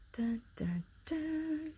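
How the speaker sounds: gasp, then a soft high whispered dun dun dun; hushed dramatic sting; mock suspense sting. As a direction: play a spooky drumroll in a whisper. Mock suspense sting after question.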